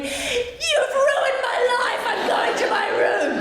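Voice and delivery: put-on high, slow, wailing kid's protest; whiny child. Son asked to wash his hands, wailing protest. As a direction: wail it like a sulking toddler. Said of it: "and he went".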